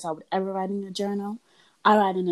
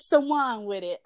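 ordinary talk, nothing marked

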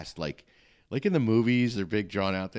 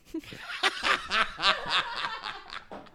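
big real laugh. Real, not acted. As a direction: burst of high laughter. Laughs at own joke.

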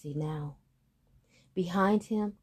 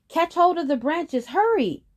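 high, loud hurried shout; urgent shout; 12 semitones higher, 8 dB louder than usual. Acted. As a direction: yell to hurry. Boy urging siblings.

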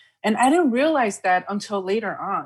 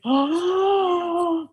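gasp of surprise; 11 semitones higher than usual. Retold or imitated.

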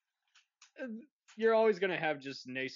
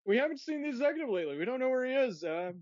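nervous cover-up voice; 8 semitones higher, faster than usual. Pretend. Acting out studio staff covering up.

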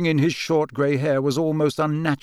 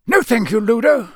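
high, louder, a bite of impatience; impatient Crouch; 9 semitones higher, 6 dB louder than usual. A narrator's reading. Official refusing a seat from his colleague, impatient. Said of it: "a bite of impatience in his voice".

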